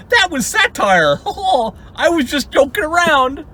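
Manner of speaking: high, hammed-up imitation of feigned innocence; mock someone laughing off their words; mock excuse-making. Mimicking nemesis's satire excuse.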